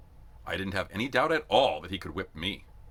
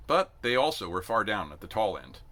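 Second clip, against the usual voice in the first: ordinary delivery, nothing marked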